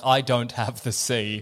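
low, slow sea witch lament; low slow disappointed Ursula. Retold or imitated. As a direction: drop deep and drag it out like a sulking villain. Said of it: "Ursula's like".

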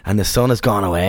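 normal talking voice

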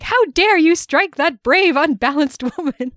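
mock gallant fury; indignant. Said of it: "he's like".

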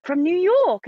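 high, questioning British kid impression; mock little British voice; British child accent. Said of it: "You've gotta do the accent".

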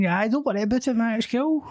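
high-pitched mock laid-back pal; breezy reassurance. Pal waving away news of a birth, breezy. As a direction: brush it off in a high put-on voice. Acts casual reply to expectant father.

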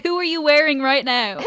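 high, loud red carpet interviewer; gushing red carpet chatter. Pretend. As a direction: gush like a red carpet host. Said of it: "Who am I wearing today?".

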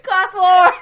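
loud, high mock declaration; all caps. Shown as a demonstration. Host proclaiming the theme to her co-host, loud and high. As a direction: shout it like a headline. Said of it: "It's in all caps in my notes".

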